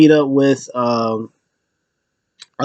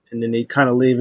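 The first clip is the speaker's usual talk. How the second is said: ordinary delivery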